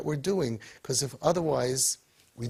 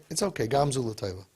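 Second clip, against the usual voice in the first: normal talking voice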